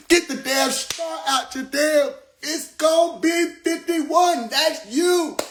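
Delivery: high hammed-up warning shout; inner voice yelling. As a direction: yell it in her ear. The voice yelling at her.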